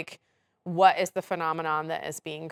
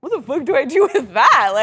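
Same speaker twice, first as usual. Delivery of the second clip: laughing quote; 8 semitones higher, 10 dB louder than usual. Retold or imitated. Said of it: "They're kinda like".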